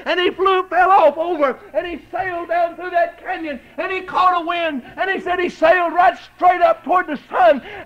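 high, loud shouted preaching; preaching at full force. Real, not acted. Preacher telling the eagle story to the church, roaring.

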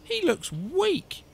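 high, drawn-out disapproving whine; grumbling. A narrator's reading. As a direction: complain slowly in a high voice. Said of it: "And she grumbled".

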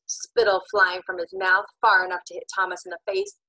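ordinary delivery, nothing marked

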